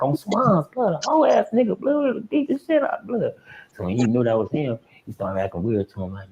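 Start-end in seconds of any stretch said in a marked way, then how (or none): 0.0-3.3 higher mock voice, rising scorn; high mocking prison threat; scornful homie. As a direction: sneer it high like a cellmate. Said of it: "He's like".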